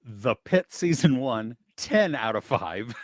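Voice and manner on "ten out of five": ordinary talk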